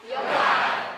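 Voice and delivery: high, loud call-out; war cry shout. Real, not acted. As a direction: lead the crowd in a cry. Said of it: "Can you say it with me?".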